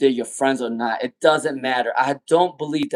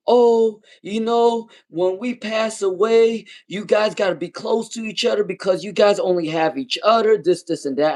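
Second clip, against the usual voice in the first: mimicking parents; 7 semitones higher than usual. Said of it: "my mom and dad was like".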